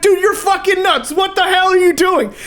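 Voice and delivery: high laughing shout of disbelief; retold shout. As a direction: yell at him like he's crazy.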